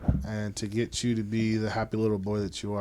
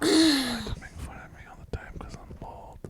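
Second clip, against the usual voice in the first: hushed mock complaint; voiceless, close-mic griping; whispered podcast voice. Shown as a demonstration. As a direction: whisper the grievance playfully. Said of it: "Talk like this".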